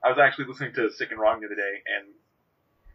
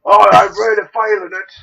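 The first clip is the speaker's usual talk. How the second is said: much higher, much louder hammed-up British rocker; 7 semitones higher, 15 dB louder than usual. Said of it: "my British voice".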